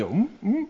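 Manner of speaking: high, soft closed-mouth mm; a muzzled dog trying to bark, high soft hum; muzzled humming. Shown as a demonstration. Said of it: "le pones bozal".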